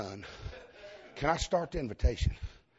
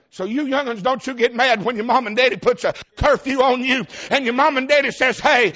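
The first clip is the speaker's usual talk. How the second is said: put-on raised preacher's exhortation to the young folks; preaching; 10 semitones higher, 11 dB louder than usual. Preacher urging young people to accept curfews, in a raised preaching voice.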